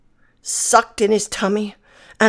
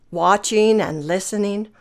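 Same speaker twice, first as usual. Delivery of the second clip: plain reading voice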